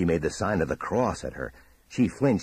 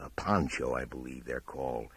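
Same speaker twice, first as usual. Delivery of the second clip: plain reading voice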